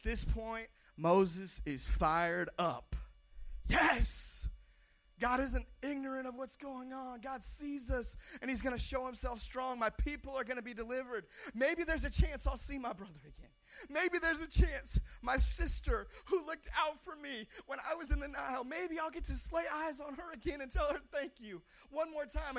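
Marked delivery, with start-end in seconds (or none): 3.7-4.5 high, loud cry of joy; excited yes. Pretend. Moses fired up, heart leaping. 5.2-22.6 hopeful excitement. Pretend. Moses' hopeful inner voice.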